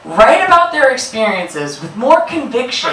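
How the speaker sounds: ordinary talk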